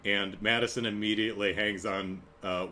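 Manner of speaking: usual speaking voice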